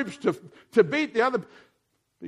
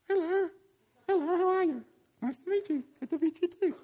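high squeaky puppet greeting; 9 semitones higher, 4 dB quieter than usual. Pretend. Preacher voicing an offering bag puppet greeting the church, high and squeaky. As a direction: make the offering bag say hello.

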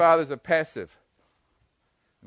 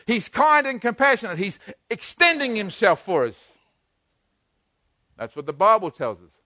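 ordinary talk, nothing marked

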